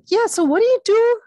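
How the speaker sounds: loud, high, curious guy voice; punched-up, inquisitive impersonation; Ray Liotta. Retold or imitated. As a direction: imitate him asking with eager curiosity. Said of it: "he's genuinely curious".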